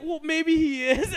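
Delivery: put-on high, loud, drawn-out kid comeback; defiant young nephew. Nephew answering uncle's teasing about Spider-Man, drawn-out childlike defiance. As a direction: stretch it out like a child insisting. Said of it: "He's like".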